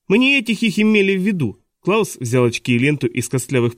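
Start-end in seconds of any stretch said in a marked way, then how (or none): 0.1-1.6 put-on higher-pitched young boy; lightly voiced higher boy's protest; boy's objection. A narrator's reading. Klaus tells teacher he brought the wrong ones, high earnest objection.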